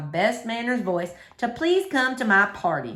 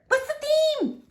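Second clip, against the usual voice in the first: put-on high little girl voice; slower than usual. Sweetie Pie asking about the party, high and little. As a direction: chirp it like a small child.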